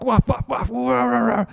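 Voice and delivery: high barking waf, waf, rah; high yapping then rough rahs; chasing dog. Acted. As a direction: bark and snarl while giving chase.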